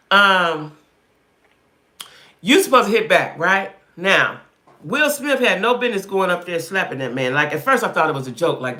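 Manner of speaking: ordinary talk, nothing marked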